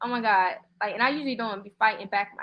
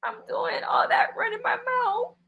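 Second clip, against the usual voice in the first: crying; 13 semitones higher than usual. Real, not acted. Streamer overwhelmed on live.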